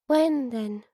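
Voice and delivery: a touch of disappointment; disappointed child. A narrator's reading. Daughter presses her father for a date, mildly disappointed. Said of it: "made a disappointed little sound".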